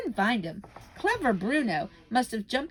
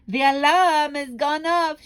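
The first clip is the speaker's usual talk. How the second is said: slow, loud, higher shriek; witch shrieking; 7 semitones higher, 9 dB louder, slower than usual. Acted. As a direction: screech it in triumph. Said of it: "shrieked the Grand High Witch".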